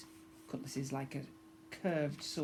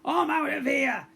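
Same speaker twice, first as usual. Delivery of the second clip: higher, louder panicked yell; 11 semitones higher, 6 dB louder than usual. A narrator's reading. Guard fleeing the pirate girl, loud yell. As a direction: bolt while shouting it. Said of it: "yelled one of Grogba's guards".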